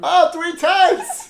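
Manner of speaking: high, loud, drawn-out laughing cry; mock-alarmed shout. Co-host catching a surname said three times, mock-alarmed shout. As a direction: shout it in gleeful mock horror.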